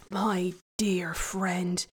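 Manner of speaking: slow breathy whisper; the devil thanking the shepherd in a whisper; hushed exclamation. Acted. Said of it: "the devil cried".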